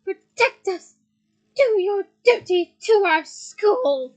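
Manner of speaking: very loud, slow commands; shouted orders. Acted. McGonagall commands the statues, loud. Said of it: "shouted Professor McGonagall".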